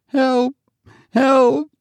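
high distant cry for help. A narrator's reading. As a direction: call out desperately from afar. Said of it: "issued a crying voice".

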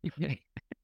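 brief amused chuckle; short laugh. Real, not acted. Amused reaction to the question.

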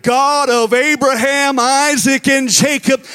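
loud, slow, ringing invocation; fervent prayer. Retold or imitated. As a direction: call the names out to the whole graveside. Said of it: "And I prayed".

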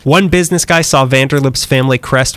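ordinary delivery

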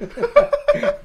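wheezing high cackle; loud high laugh. Real, not acted. Laughing at himself.

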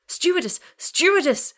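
high, urgent yell for help; panicked shout. A narrator's reading. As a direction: shout for the stewardess down the cabin. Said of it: "he shouted".

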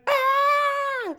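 shrill-high squawking animal scream; mock alarm scream. Friend mocks the koala alarm with a high scream. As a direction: shriek like a panicked koala. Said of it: "just being like".

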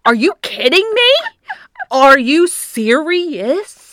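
high, slow, loud mock outrage; epically dramatic. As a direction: stretch out each incredulous question. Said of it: "being epically dramatic".

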